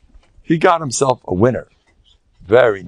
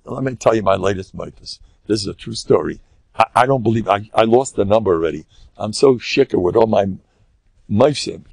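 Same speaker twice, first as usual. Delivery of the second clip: ordinary talk, nothing marked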